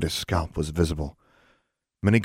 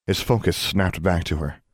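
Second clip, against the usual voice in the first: plain reading voice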